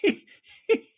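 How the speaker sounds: high, slow, squeaky-tearful laughing speech; helpless laughter. Real, not acted. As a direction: barely get the punchline out for laughing. Teller cracks up at punchline.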